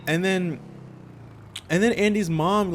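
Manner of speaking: usual speaking voice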